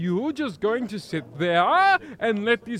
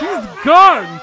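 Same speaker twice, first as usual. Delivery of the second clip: louder startled shout; 5 semitones higher, 13 dB louder than usual. Acted. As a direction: yell it out in shock. Reporter exclaims as M vanishes.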